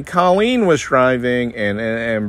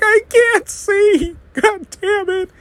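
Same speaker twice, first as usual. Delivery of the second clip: very high, slow, strained wail with a laugh; hammed-up helpless cry; mock panic; slower than usual. Dan acting out being snow blind, high slow laughing wail. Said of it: "It's like".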